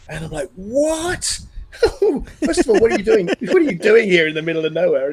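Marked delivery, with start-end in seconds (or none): 0.6-2.1 high, stretched incredulous yelp; mock-stunned "what"; astonished. Retold astonishment at surfer's story. 2.4-5.1 loud baffled questioning, starting high; raised voice, mock bewilderment; incredulous. Retold baffled reaction to surfer.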